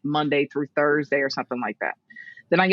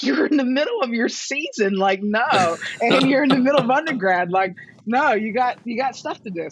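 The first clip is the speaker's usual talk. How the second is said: higher, emphatic imitation of protesting parents; retold parental objection; 6 semitones higher than usual. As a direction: mimic parents firmly talking a daughter out of it.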